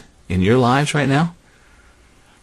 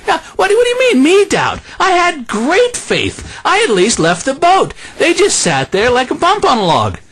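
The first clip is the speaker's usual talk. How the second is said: much higher, laughing, offended; huffy Peter; 13 semitones higher than usual. Pretend. Peter griping about the others in boat, high and laughing. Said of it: "I would be thinking".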